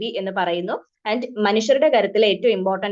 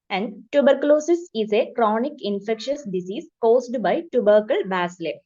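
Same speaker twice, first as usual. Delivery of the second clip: very slow, word-by-word dictation of a definition; much slower than usual. Shown as a demonstration. Teacher dictating a definition to nursing students, slow and even. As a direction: read it out slowly so students can write. Reads textbook definition for notes.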